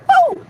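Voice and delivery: high, loud "woo"; whoop. Real, not acted. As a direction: punctuate the chant with a shrill whoop. Whoops during own chant.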